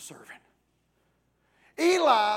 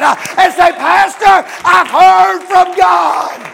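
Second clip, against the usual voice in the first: building shout; 5 semitones higher, 10 dB louder than usual. Acted. Preacher to youth group, voicing their vow, rising to a shout. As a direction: build the vow into a roaring cry.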